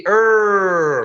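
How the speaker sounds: ER loud and held, tongue curled; curled American R. Shown as a demonstration. Teacher leading everybody to repeat, long loud curled ER. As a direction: lead with a long untrilled ER. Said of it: "repeat after me".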